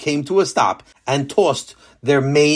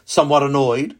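plain reading voice

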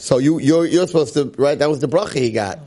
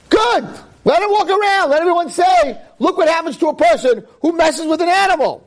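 fiery declaration. Retold or imitated. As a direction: shout the verdict to the town. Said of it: "That's what I would say".

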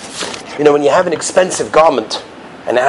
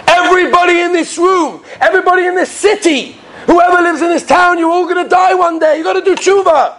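fiery maggid preaching; 13 semitones higher than usual. Acted. Maggid warning villagers they will die, wailing sermon. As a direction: warn the whole crowd with stretched-out cries. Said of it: "So then he goes again and again".

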